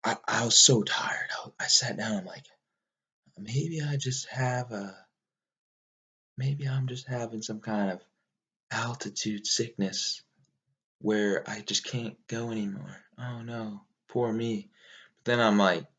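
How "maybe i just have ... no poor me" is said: soft, slow self-talk; self-pitying inner voice. Retold or imitated. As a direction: murmur your doubts like a mock pity party. Voicing own self-pitying doubts while climbing.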